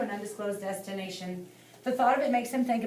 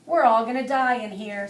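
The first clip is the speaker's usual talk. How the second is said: stuffy-nosed wail, louder; nasally voice; 8 dB louder than usual. A narrator's reading. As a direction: whine it through a blocked nose. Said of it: "says a nasally voice".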